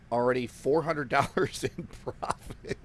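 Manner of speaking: words dissolving into real laughter; laughing in disbelief. Real, not acted. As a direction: start steady, then crack up laughing mid-sentence. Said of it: "I'm laughing because you just can't".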